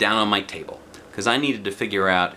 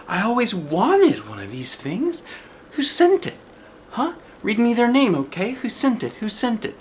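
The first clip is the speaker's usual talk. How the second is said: much higher, pleading and pushy; eager child; 14 semitones higher than usual. Acted. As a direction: demand the name excitedly. Junie B begging for name.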